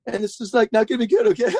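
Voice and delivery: high, cracking guru impression; [name] as voice-cracking Yoda. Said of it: "like a voice cracking version of Yoda".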